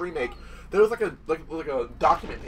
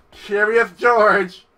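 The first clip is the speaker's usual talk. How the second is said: drawn-out taunt; 6 semitones higher, 11 dB louder, much slower than usual. Real, not acted.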